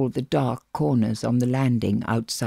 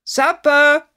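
loud, high sing-out call; 14 semitones higher, 7 dB louder than usual. A narrator's reading. Jack calls the children to supper from below. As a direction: call it up to another floor. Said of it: "Jack calling up the staircase from the gallery below".

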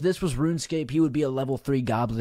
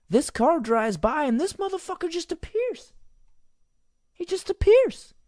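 incredulous outburst. Real, not acted. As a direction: voice climbing into a high squeal. Amazed retelling of the replay.

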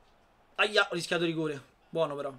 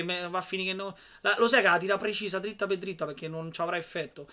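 ordinary talk

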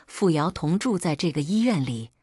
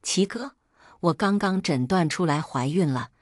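usual speaking voice

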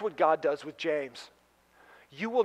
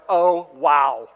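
dismayed; 3 semitones lower, 6 dB louder than usual. Retold or imitated. Owner reacting to soundbite.

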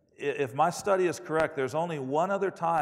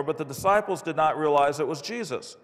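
ordinary talk

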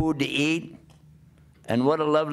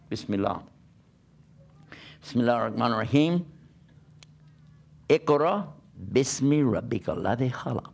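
Quranic recitation; 3 semitones lower than usual. Acted.

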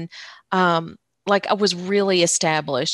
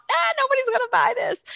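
high, fast squeaky self-doubt voice; mock doubting inner voice; faster than usual. Remembering her fear about her first book, in a mock high doubting voice. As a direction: toss it off like a silly little naysayer. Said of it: "which I thought".

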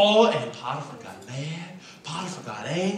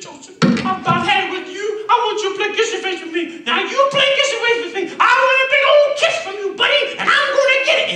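loud, very high falsetto demand, rushed; hammed-up woman losing patience, shrieky falsetto; fed-up temptress; 11 dB louder than usual. Potiphar's wife demanding a kiss from Joseph, fed-up falsetto. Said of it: "One day she had had enough".

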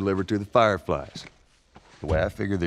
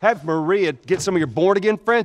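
sharp, raised, exasperated voice; angry outburst; 10 semitones higher, 6 dB louder than usual. Acted. Older brother yelling at Tommy in anger. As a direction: snap at him in frustration. Brothers arguing over the mission.

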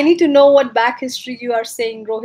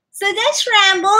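higher squeaky pet talk; 5 semitones higher than usual. Real, not acted. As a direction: show off the dog, squeaky. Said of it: "that was my pet voice".

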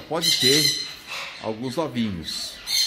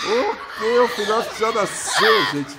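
excited cheer; 7 semitones higher, much slower than usual. Real, not acted. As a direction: burst out laughing with joy. Chick born, cheering to viewers. (anunciando rindo, eufórico)

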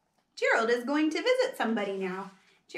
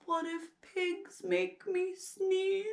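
slow, hushed, higher worried voice; dawning worry; 7 semitones higher, 7 dB quieter, slower than usual. Acted. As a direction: softly voice a dreadful thought. Gerald voices a dreaded thought.

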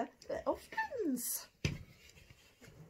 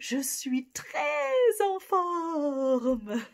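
louder and bright with stress on très; energized; 6 semitones higher, 9 dB louder, much slower than usual. Shown as a demonstration. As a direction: bounce je suis très en forme out louder. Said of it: "you're even better".

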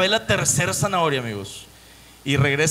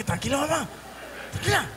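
soothing mom; 8 semitones higher, 7 dB quieter than usual. Retold or imitated. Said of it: "así que".

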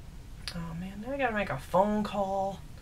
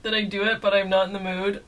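ordinary talk

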